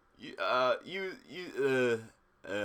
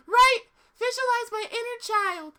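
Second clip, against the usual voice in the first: squeaky high cartoon girl; high girly cartoon voice; 7 dB louder than usual. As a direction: pitch way up, bright and bouncy. High character voice.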